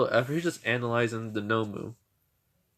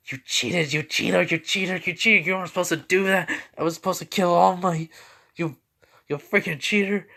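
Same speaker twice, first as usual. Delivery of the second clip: high, loud acted tantrum; outraged accusation; 7 semitones higher, 6 dB louder than usual. Villain accuses the boy of cheating, outraged. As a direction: sore loser yelling in protest.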